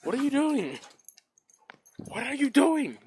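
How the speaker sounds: high, loud teasing call; teasing the dog, high and loud; playful dog talk. Real, not acted. Baiting dog in yard.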